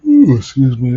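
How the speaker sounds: early-morning yawn breaking into speech; talking through a yawn. Real, not acted. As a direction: let a big yawn drag the words out. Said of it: "it's five in the morning".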